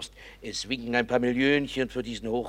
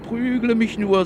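much higher, louder, slow defiant plea; defiant cry; 12 semitones higher, 6 dB louder than usual. Acted. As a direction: fling it at him, daring the blow. Daring him to strike, repeated. (trotziger Ausruf, viel höher und lauter, langsam)

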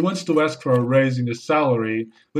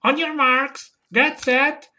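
high parrot squawk; parrot starting a race; 10 semitones higher, much slower than usual. Acted. Parrot bursts out of the bag to escape, high squawking parrot. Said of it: "the parrot comes out of the bag and says".

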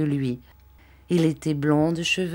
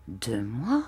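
low, softer echoed question rising at the end; concerned surprise; 5 semitones lower, 5 dB quieter than usual. A narrator's reading. Lady hearing how long the child is alone, surprised. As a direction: repeat it softly, taken aback. Lady reacts to boy's answer.

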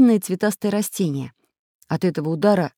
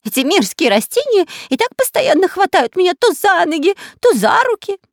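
high, loud whining complaint; whiny complaining; 14 semitones higher, 6 dB louder than usual. A narrator's reading. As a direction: whine about it like a spoilt child. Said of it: "жаловался разбойник".

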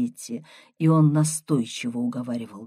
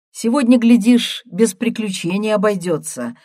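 ordinary delivery, nothing marked

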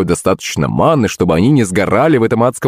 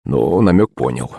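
ordinary delivery

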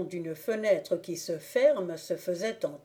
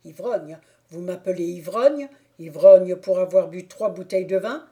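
put-on offended drunk; 7 dB louder than usual. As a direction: repeat the insult in disbelief. Thibault echoes the insult.

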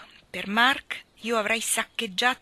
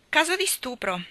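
cheerful; 7 semitones higher, 3 dB louder than usual. A narrator's reading. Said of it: "spiegò allegramente".

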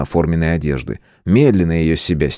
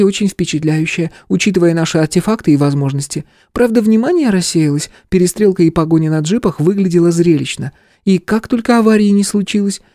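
higher female voice, lively retelling; young woman voice; 12 semitones higher than usual. Acted. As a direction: tell it like an impressed young woman. Stella recounts the chase. (оживлённый женский голос)

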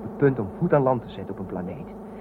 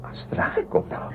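softer, whispered plea; coaxing whisper; 5 dB quieter than usual. Acted. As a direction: draw her close with a whisper. Duif coaxing Astra intimately. (fluisterend smekend)

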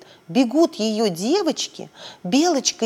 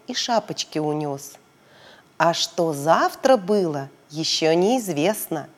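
usual speaking voice

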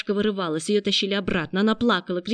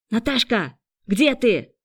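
loud, higher-pitched calling; anxious raised-voice call; searching call; 4 semitones higher, 6 dB louder, slower than usual. A narrator's reading. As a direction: call out for someone lost in the dark. Calling for lost girl.

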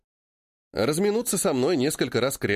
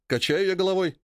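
ordinary delivery, nothing marked

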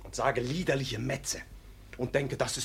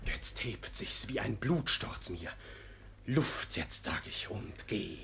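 hushed intensity; 5 semitones lower, 9 dB quieter, slower than usual. Acted. Ruprecht tells of his rage.

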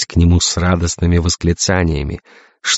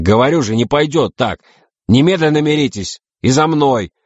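high, exasperated commanding; insistent order rising sharply in pitch; barked orders; 9 semitones higher than usual. A narrator's reading. Navigator orders captains to make up, barking. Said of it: "рявкнул Кошкин".

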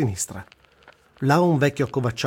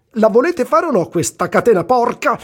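high, loud exasperated shout; shouting at the men to form the chain; angry yell; 11 semitones higher, 7 dB louder than usual. Retold or imitated. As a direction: yell it at them to get them moving. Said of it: "comincio a urlare in italiano".

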